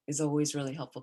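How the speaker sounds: ordinary talk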